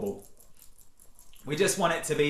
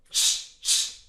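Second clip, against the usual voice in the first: two voiceless punches of air; sharp little shh bursts; short shushes. Shown as a demonstration. Coach repeats the yell set-up, two short shushes.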